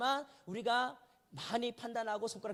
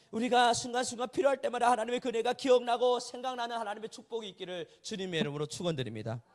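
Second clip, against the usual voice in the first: a formal blessing, swelling louder at the start; closing benediction; 6 dB louder than usual. Acted. Pastor blessing the congregation in Jesus' name, formal benediction. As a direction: bless the congregation as the sermon closes.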